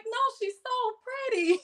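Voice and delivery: put-on little girl voice. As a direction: gush like a seven-year-old. Child self voiced in story.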